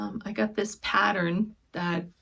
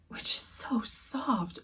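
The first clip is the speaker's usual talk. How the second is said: whisper; 2 semitones higher, 6 dB quieter, slower than usual. Real, not acted. Feeling soft lace.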